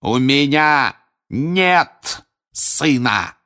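father disowning his son, shouting; overpowering shout. A narrator's reading. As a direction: drown out the whole hall. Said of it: "Голос Крауча перекрыл все остальные звуки".

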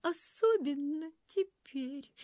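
high, slow tearful voice; sobbing willow. A narrator's reading. Willow soul after a sob, tearful. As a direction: add it through a sob. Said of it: "всхлипнув".

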